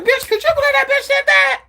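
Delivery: scandalized squeal at the gossip; talking to herself out loud. Retold or imitated. Telling herself off about a rude person, in a high, loud, outraged voice. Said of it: "I will say out loud".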